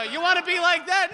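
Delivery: put-on dad warning the kids; stern father. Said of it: "he'd go".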